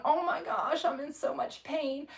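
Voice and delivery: imitating herself complaining of pain to her husband, high whine; mock whining.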